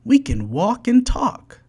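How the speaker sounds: high, bright character voice; Ted's voice. A narrator's reading. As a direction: chirp it like a cheerful friend. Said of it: "said Ted to Fred".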